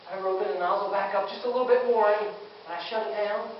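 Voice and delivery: usual speaking voice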